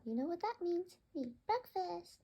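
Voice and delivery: high, eager chirp of joy; excited bird voice. Acted. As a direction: squeal it like a hungry bird. Bird excited about breakfast.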